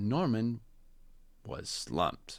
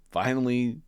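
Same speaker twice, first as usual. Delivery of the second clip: plain reading voice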